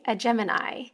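ordinary talk

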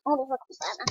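ordinary delivery

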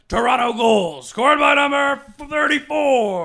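high, slow, drawn-out goal call; animated arena announcer impression. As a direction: stretch the goal announcement up high, ending on a long number. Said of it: "his son is just like".

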